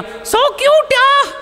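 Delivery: high-pitched, drawn-out squeal; mimicking an over-gushing girl's squeal; gushing female colleague. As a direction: coo it sky-high, stretching every word. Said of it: "उसमें से एक ने बोल दिया".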